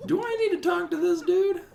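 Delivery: squeaky high character voice; high falsetto voice. Acted. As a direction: voice a puzzled game character. Falsetto game character line.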